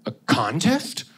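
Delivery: alarmed question, pitch leaping up at the end; scared hot dog. Acted. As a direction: play a frightened hot dog. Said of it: "impression of a really scared hot dog".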